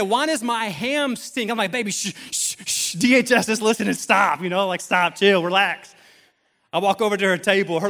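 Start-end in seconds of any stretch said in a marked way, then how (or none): 0.0-1.5 put-on higher, louder, slow child's question; imitated yelling five-year-old. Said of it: "yelling across all her friends". 1.7-5.9 loud, urgent comic hushing; mock-panicked shushing. Panicked dad shushing his daughter.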